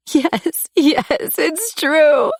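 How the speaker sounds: high tearful confession; crying. A narrator's reading. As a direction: cry it out, confirming. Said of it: "I cried".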